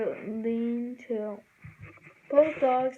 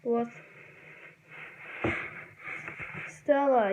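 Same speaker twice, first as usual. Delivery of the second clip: croaky frog voice. A narrator's reading. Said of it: "yelled someone with a voice that sounded like a croaky frog".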